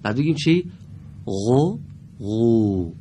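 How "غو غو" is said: غو from the throat, not a ق stop; غو, thick and drawn from the back of the throat; correct غو. Shown as a demonstration. Teacher correcting a pupil's ق into a thick غو. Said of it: "اگه بگیم قو انگار قاف گفتیم".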